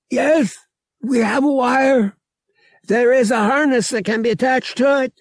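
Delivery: very high, loud creature voice; Murg's character voice. Acted. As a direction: answer in a high, forceful alien voice.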